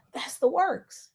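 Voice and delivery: soft breathy whisper; whispered aside. Real, not acted. Hushing the punchline to her viewers in a whisper. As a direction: drop to a whisper for the point. Aside after reading scripture.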